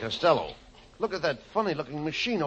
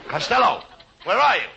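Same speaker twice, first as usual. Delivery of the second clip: loud, raised-pitch call; shouted search call; 6 semitones higher, 6 dB louder than usual. Acted. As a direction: holler for your missing partner. Calling out for fallen partner.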